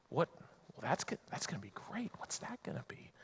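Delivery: hushed awed whisper; mock whisper. Preacher whispers like an awed child hearing the news. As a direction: breathe it out as a wide-eyed child.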